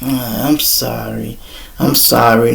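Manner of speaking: soft, slow, sheepish sorry; meek apology. Retold or imitated. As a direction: apologise small and quiet. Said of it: "She like".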